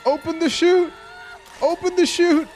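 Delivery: very high, loud urgent shouting; panicked yell. Real, not acted. Player yelling at falling Lara, panicked. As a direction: scream instructions in a panic.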